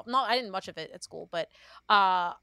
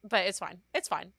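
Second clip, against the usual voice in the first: usual speaking voice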